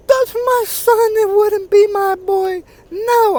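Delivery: very high, loud falsetto mocking a frantic mother; mock hysterical mother. Ridiculing the mother denying her son did it, shrill mock falsetto. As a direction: shriek it like a hammed-up distraught mom. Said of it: "you've got no business being like".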